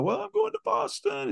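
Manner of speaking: squeaky high-pitched nervous voice; cornered man's squeak an octave up; voice jumps an octave. Retold or imitated. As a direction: answer way up high, caught out and flustered. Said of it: "my voice is at an octave it's never at before".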